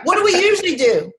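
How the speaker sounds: laughing. Real, not acted. Laughing rhetorical question.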